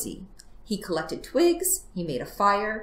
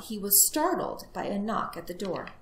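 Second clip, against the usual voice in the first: usual speaking voice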